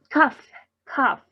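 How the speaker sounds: "cough" said with a final F sound; teacher's demo of "cough" ending in F. Shown as a demonstration. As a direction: end "cough" on an F, as a model word. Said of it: "como si al final tuviera una F".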